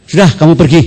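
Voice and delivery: monk dismissing the woman; curt dismissal. Retold or imitated. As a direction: wave her away firmly.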